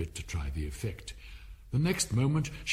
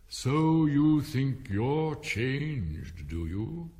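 very slow, higher and drawn out; haughty caterpillar; 3 semitones higher, 3 dB louder, much slower than usual. A narrator's reading. Caterpillar questioning Alice's change, slow and haughty. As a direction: stretch each word like a haughty caterpillar.

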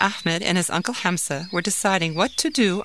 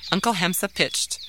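usual speaking voice

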